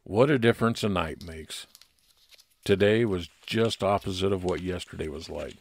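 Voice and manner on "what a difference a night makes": ordinary talk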